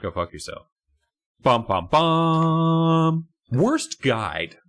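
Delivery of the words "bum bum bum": slow, high, drawn-out suspense hum; dramatic sting. Acted.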